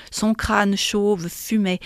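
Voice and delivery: ordinary delivery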